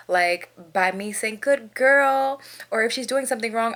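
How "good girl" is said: higher, louder praise for a dog; encouraging dog-owner voice; praising. Shown as a demonstration. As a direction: praise the puppy brightly. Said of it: "positive reinforcement is so good".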